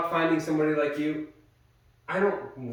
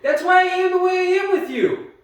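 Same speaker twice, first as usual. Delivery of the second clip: raised voice; 12 semitones higher than usual. Real, not acted. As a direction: plead it at the top of your voice. Pleading loudly in fight.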